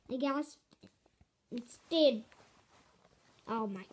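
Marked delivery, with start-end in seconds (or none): none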